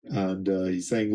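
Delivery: ordinary talk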